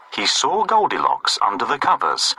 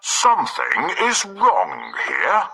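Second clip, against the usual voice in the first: Father Bear voice; 5 semitones higher than usual. Acted. Father Bear's line.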